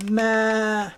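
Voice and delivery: long, high "maa"; goat bleat. Acted. Goat greets little pig with a drawn-out bleat. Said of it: "Mister Goat".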